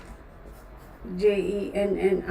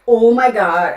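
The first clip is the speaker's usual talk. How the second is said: dismay; 9 dB louder than usual. Real, not acted. Repeats dismay at mistake.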